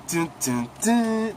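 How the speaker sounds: dramatic sting, last dun jumps high; chipmunk dun dun dun. Retold or imitated. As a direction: hum the three beats, last one squeaky high.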